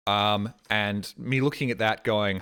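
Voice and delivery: ordinary delivery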